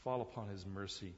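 usual speaking voice

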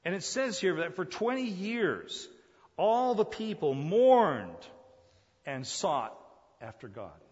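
high, loud, drawn-out cadence; fervent preaching. Real, not acted. Preacher tells how all the people mourned, fervent preaching. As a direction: stretch the key words fervently.

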